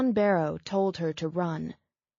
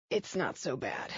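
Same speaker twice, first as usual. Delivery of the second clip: much softer, lower strained line; strained and quiet; 4 semitones lower, 7 dB quieter than usual. Acted. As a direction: grit through the pain softly. Man in pain downplaying.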